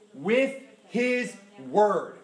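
very slow, each phrase drawn out and weighted; slow emphatic preaching. Acted. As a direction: hammer home each answer slowly. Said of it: "preaching emphasis".

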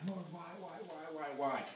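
soft, tired lament to God; weary plea. Retold or imitated. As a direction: sigh out the whys, worn down. Retelling his own weary plea.

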